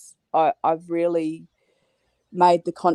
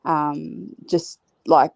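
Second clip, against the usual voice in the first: ordinary talk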